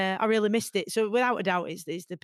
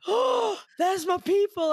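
put-on high, loud joyful exclamation, Manc accent; delighted cry; 12 semitones higher, 4 dB louder, slower than usual. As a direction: burst out with relief at hearing home voices. Joy hearing home accent at gate.

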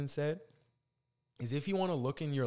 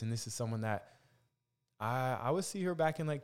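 normal talking voice

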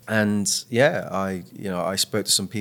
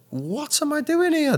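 much higher, slow, stunned self-quote; disbelief. Retold or imitated. On Question Time, asking himself, in high disbelief. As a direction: squeak it out, out of your depth. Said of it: "and I'm like".

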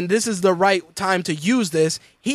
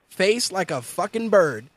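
ordinary talk

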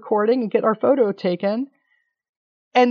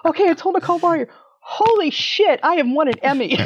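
giddy inner thought; 7 semitones higher than usual. Retold or imitated. New Emmy winner to herself after telling Nicole, high and giddy. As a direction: squeal it like you can't believe it. And I was like.

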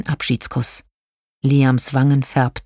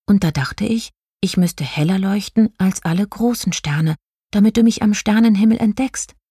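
put-on higher, small child-like star voice; lightly voiced little one, pitched up; quiet little star; 6 semitones higher than usual. A narrator's reading. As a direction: confess shyly as a tiny star. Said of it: "sagte er leise".

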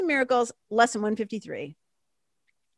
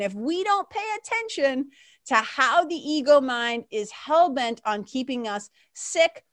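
ordinary delivery

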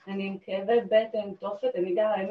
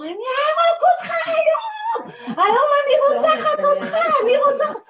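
screaming, much higher and louder, out of control; furious threat; 6 dB louder than usual. Retold or imitated. Mother screams at the burglar in her hallway, enraged. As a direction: shriek the death threat at the intruder. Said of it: "והתחלתי לקלל אותו".

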